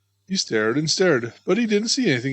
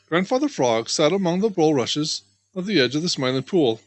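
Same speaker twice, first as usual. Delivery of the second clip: plain reading voice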